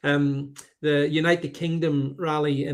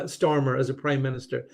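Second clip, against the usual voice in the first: ordinary talk, nothing marked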